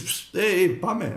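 high, loud cheeky whistle-call come-on; hammed-up flirty catcall; mock catcall. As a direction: toss off a teasing street pickup call. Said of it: "Τι θα σφυρίξεις".

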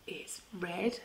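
soft whispered answer; hushed reply. Shown as a demonstration.

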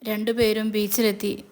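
ordinary delivery

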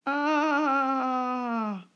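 high startled badger yelp; startled cry. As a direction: cry out as the ground gives way. Badger cries out as ground slides.

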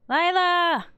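excited greeting; excited call. Acted. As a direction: call out to a friend gladly. Greeting friend by text.

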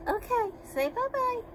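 high sing-song pet voice. Real, not acted. Baby talk goodbye to pet.

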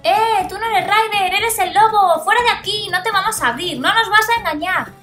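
loud, high angry yelling; angry shouting. Acted. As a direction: shout the intruder away. Pups catch the wolf out.

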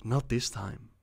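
ordinary delivery, nothing marked

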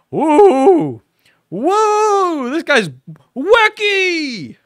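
loud, very high whooping; mock fan excitement. Viewer watching Shane Dawson videos, hammed-up excitement. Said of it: "I watch Shane Dawson videos and I say".

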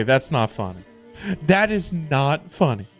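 normal talking voice

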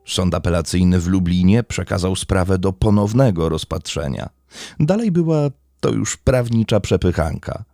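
steady, measured storytelling read; crime podcast narration. Acted. Host recounting a murder trial to listeners, in a steady narrative read. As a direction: lay out the court case like a documentary narrator.

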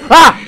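high, loud short shriek; startled scream. Real, not acted. Gamer scared mid-question, startled scream.

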